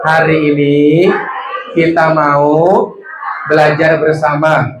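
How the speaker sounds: put-on slow, phrase by phrase, for the children to repeat; slow dictation. Shown as a demonstration. Teacher dictating to children, slow and phrase by phrase. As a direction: lead the kids word by word, slowly. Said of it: "Bilang sama anaknya".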